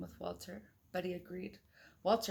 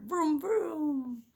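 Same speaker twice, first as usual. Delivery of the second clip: toy car vroom; 8 semitones higher, 6 dB louder than usual. Pretend. Toy car noise for a child.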